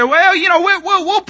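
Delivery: mock dodging with a squeaky stammer; hemming and hawing. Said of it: "We say".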